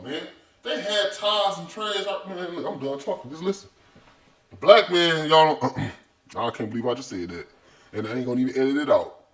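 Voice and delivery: ordinary talk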